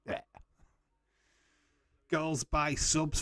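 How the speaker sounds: usual speaking voice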